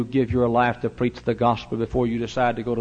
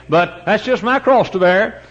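mock martyrdom; 7 semitones higher, 4 dB louder than usual.

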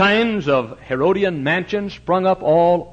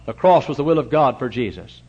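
normal talking voice

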